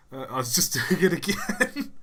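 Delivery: high, loud, helpless laughter; dissolving into giggles at yourself; laughing. Real, not acted. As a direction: crack up while talking. Cracks up at own accent.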